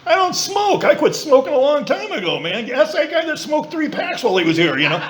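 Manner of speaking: high, mocking refusal; high hammed-up grumble from his head; indignant inner voice. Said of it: "My head says".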